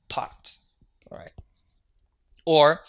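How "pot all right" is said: ordinary talk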